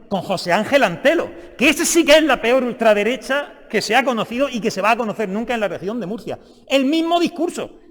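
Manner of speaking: loud, high-pitched denunciation; heated accusation. Real, not acted. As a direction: throw the accusation across the chamber, loud. Heated accusation in parliamentary debate.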